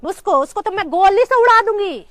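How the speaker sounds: loud, furious vow; angry threat. Acted. Wife vowing to shoot the rival, furious. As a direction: let the threat rise in volume.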